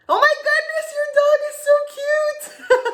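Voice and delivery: very high, loud squeal of delight; gushing squeal. Acted. Spotting the friend's cute dog on screen, gushing. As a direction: squeal it, breaking into laughter.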